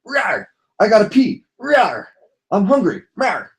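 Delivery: be a needy little pup demanding things; talking puppy. Pretend. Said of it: "They just talk".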